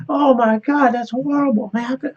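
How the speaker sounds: high, loud imitated dismay; retold horror. Rider reacting to the driver's dead dog, imitated high dismay.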